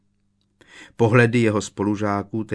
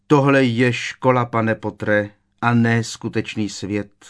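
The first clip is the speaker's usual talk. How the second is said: usual speaking voice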